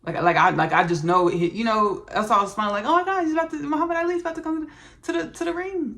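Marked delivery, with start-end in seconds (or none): 2.8-5.9 high, fast giddy gush; excited squeal. Retold or imitated. Host retelling her thrill at channeling Ali, high giddy gush. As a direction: gush like a starstruck fan. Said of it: "That's how I was smiling".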